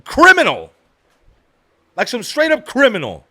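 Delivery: high-pitched, worked-up protest; indignant rant. Retold or imitated. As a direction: rant in disbelief, punching key words. Indignant answer about steep sentence.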